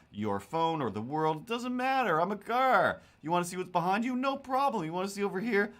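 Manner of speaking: high, bright-eyed eager car voice, way up in pitch; a gadget-stuffed car showing off every feature; overeager talking car. Retold or imitated. Modern car greets the driver, high and eager. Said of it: "and you're just like".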